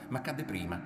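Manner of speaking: ordinary delivery, nothing marked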